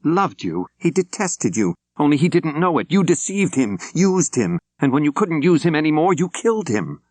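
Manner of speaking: angry reverend accusing her rival; angry accusation. A narrator's reading. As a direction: hurl it at her with open anger. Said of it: "Carolyn Armstrong's overt anger".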